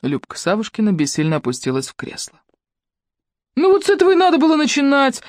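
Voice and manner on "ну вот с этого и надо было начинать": grumbling. A narrator's reading. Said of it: "буркнул муж Елены".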